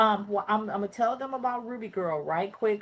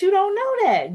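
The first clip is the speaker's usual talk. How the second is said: emphatic exclaim; 12 semitones higher, 8 dB louder than usual. Real, not acted. Host exclaims to audience.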